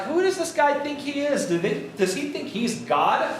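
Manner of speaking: high-pitched, indignant disbelief; mock incredulous. Jacobins gaping at Robespierre posing as Caesar, incredulous.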